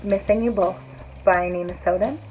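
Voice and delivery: normal talking voice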